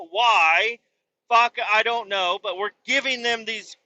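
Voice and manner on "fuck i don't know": ordinary delivery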